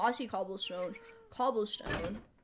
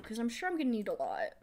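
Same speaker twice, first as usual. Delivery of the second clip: ordinary talk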